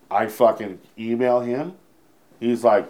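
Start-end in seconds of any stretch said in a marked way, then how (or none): none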